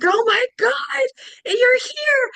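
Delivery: very high, loud delighted squeal; overjoyed greeting. Retold or imitated. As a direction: imitate a friend's thrilled recognition. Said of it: "his face lights up".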